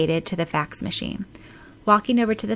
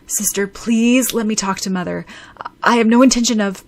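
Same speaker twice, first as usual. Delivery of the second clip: rising, louder protest; exasperated pleading; 3 semitones higher, 6 dB louder, faster than usual. A narrator's reading. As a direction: insist urgently, building to a loud objection. Pleads with sister, gets cut off.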